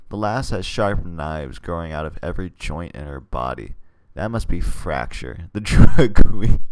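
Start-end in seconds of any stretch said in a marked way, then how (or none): 5.5-6.6 laughing. Real, not acted. Laughing while reading villain name.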